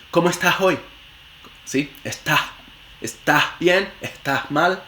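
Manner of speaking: "estás" with a weak aspirated s; s-dropping speaker. Retold or imitated. Teacher imitating speakers who eat their s's. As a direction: swallow the s in "estás" and "cómo estás hoy".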